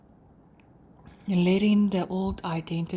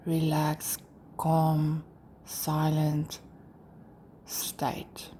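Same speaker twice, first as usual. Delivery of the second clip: guided meditation. Acted. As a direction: lead them gently through breathing and release, pausing between phrases.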